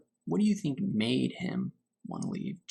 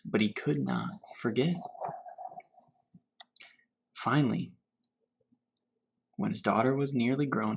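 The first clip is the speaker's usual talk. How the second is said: unhurried storybook reading to children, lightly voiced; story reading; 3 semitones lower than usual. A narrator's reading. Teacher reading Grandfather's Journey to her class, gentle storytelling. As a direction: read it gently, letting each image settle. Teacher reads picture book aloud.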